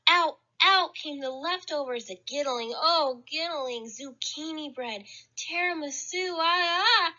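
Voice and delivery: usual speaking voice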